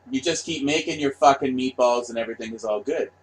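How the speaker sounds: ordinary talk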